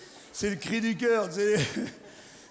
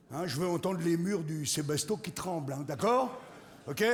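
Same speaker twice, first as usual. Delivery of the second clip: ordinary talk, nothing marked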